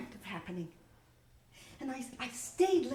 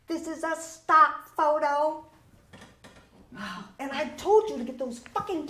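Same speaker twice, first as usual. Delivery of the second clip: bossy shout; 5 semitones higher, 8 dB louder than usual. Acted. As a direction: bark it at the gawking new girl. Boss scolding the assistant.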